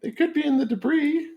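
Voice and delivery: high, loud granny voice; Grammy voice. Acted. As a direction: play a sweet old granny, high and bright. Goblin still voicing Grammy.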